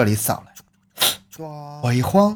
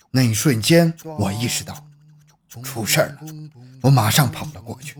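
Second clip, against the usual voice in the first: ordinary talk, nothing marked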